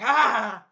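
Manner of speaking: high, loud imitated shriek; dragon cry. Dragon attacked by hero, loud mock cry.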